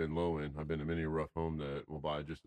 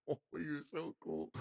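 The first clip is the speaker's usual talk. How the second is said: high, soft, drawn-out cooing; imitated cooing; 9 semitones higher, 6 dB quieter, slower than usual. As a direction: coo slowly, high and quiet, as if to a cat. Fawning over the old cat.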